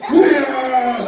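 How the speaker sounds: short loud playful roar; mock giant roar. Teacher roars as Goliath for the children, playful roar. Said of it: "골리앗이 소리를 질렀어요".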